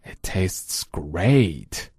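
very slow and quiet It tastes great; drawn-out gentle reading; slow, soft model. Shown as a demonstration. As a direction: savour the words slowly and softly. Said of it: "说的是熊叔做的红烧肉。".

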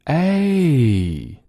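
A held long and pitched high, gliding a to e. Shown as a demonstration. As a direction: sing out a drawn-out A for students to copy. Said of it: "再来一遍。".

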